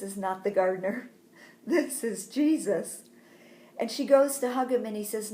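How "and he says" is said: ordinary delivery, nothing marked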